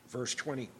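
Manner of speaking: soft murmured repeat of the verse; quiet repeat. Real, not acted.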